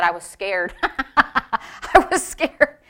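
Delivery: amused retelling through laughter; helpless chuckling over a quote; laughing. Real, not acted. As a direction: break up laughing on the words. Laughs while retelling being scared.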